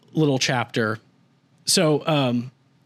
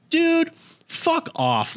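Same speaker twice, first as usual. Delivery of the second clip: slow, much higher, put-on voice of the other guy; mock dismissive; much slower than usual. Arthur waving off Stuart who's pestering him, mocking and slow. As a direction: drag out a sneering brush-off.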